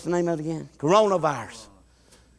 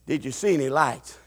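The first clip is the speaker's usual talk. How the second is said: ordinary delivery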